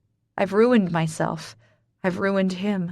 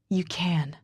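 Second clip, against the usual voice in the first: soft reassurance; 5 dB quieter than usual. Acted. Ford comforts tenderly.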